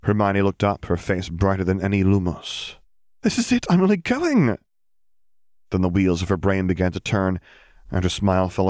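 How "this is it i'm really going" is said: high, bright burst of joy; excited. Acted. Girl thrilled at her acceptance letter, excited. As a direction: she can't believe her luck.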